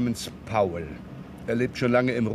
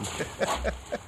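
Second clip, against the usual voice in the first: soft chuckle; 6 semitones higher, 7 dB quieter than usual. Acted. Inspector chuckles.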